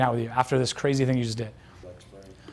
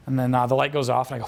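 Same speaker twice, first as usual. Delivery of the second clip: ordinary delivery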